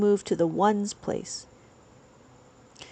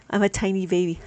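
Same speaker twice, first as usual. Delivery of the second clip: usual speaking voice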